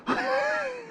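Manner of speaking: very high, soft squealing laugh; elated laugh. Real, not acted. Angler overjoyed at his bass, high giddy laugh.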